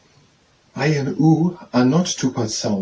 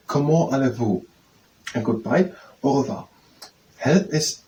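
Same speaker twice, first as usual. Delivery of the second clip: normal talking voice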